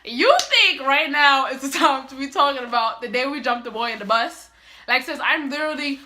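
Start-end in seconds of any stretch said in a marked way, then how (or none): 0.0-4.5 loud, raised-pitch opening that settles; acted exasperated challenge; incredulous rebuke. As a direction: scold in disbelief, re-enacting it. Retold rebuke to sister.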